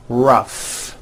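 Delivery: ROUGH ending on an airy, audible F; stretched F. Shown as a demonstration. Teacher modelling GH as F, stretched F in ROUGH. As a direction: draw out the final F in ROUGH. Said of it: "Notice the G H combination".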